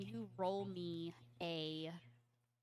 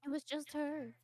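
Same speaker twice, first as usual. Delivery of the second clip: higher voice, repeating and stumbling; frightened child; 6 semitones higher, 3 dB louder, faster than usual. Acted. Lost girl describing her captor, anxious and higher.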